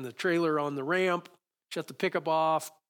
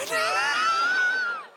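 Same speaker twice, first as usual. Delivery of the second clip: loud, high burst of laughter; big laugh; 7 dB louder than usual. Real, not acted. Pastor to congregation after punchline, loud high laugh. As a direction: laugh out loud at your own joke.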